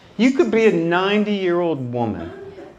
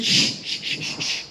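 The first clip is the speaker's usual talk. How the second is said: urgent unvoiced shushing; breathy shh to silence the room; shushing whisper; 6 dB quieter than usual. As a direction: hush him sharply under your breath. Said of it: "She said".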